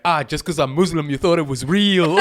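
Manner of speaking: high, loud film-character quote; mock taunting reveal. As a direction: imitate him mocking the fooled crowd.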